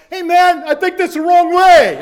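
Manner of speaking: high, loud call from the sidelines; sideline yell. Retold or imitated. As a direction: shout it across to a runner. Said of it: "some guy on the sidelines yelled".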